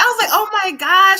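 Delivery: high-pitched delighted exclamation; retold excitement. Host retelling her reaction to Lola's work, retold excitement. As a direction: gush as if reliving it.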